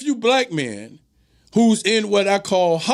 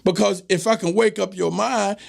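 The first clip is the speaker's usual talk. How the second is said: ordinary talk, nothing marked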